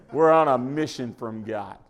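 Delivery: put-on half-hearted go at a movie character's accent; Blues Brothers impression. Preacher quoting a comedy film to the congregation, half-hearted impression. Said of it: "I can't speak like that".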